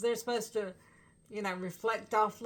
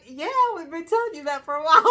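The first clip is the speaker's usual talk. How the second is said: teasing retort; 6 semitones higher, 12 dB louder, faster than usual. Retold or imitated. Teasing reply to singer.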